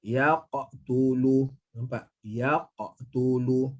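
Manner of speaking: Yaqtulu drawn out very slowly, qaf bounced; slow teacher's model of Yaqtulu; slow recitation demo. Shown as a demonstration.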